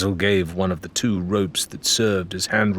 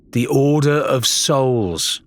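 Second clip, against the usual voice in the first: Lorena dawning realisation; 6 semitones higher, 4 dB louder, slower than usual. A narrator's reading. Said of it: "Lorena finished, understanding beginning to dawn".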